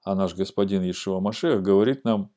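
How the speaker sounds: ordinary talk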